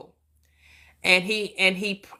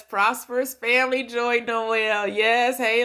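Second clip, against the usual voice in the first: usual speaking voice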